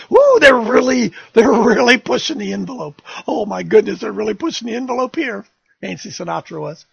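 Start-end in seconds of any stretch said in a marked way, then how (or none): 0.1-5.2 mock excitement. Mocking the risque dancers excitedly.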